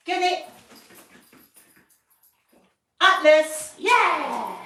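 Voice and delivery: loud, high, hyped-up get it; excited cue. Real, not acted. Trainer hyping the puppy up to grab the toy, loud and high. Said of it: "is I can say".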